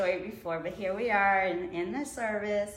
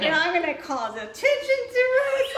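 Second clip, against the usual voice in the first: half-laughing worry; 11 semitones higher, 10 dB louder than usual. Retold or imitated. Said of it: "I'm like".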